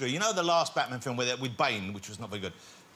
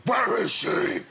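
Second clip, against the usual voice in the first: loud, raised rasping demand; Batman; 8 semitones higher, 5 dB louder, much slower than usual. Acted. Panellist showing the actor's normal acting shouting, Batman roar. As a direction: roar it like a menacing superhero interrogator. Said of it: "That is a terrifyingly good Batman".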